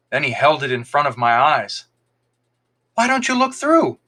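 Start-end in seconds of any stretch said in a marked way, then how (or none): 2.9-4.0 bright, high, eager invitation; cheerfully. A narrator's reading. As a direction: coax him with childlike glee. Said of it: "he said cheerfully".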